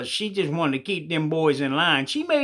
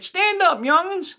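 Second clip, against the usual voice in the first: much higher and louder, a ringing order; preacher's command; 10 semitones higher, 3 dB louder, slower than usual. Acted. Preacher ordering the boys to stand, loud and ringing. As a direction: call them up like a pulpit preacher.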